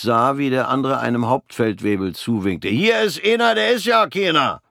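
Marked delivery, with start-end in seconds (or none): 2.7-4.6 high, loud gloating jeer; gleefully mocking. Corporal mocks Fritz to the sergeant, high and gloating. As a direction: crow over your own joke. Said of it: "fand sich irrelustig".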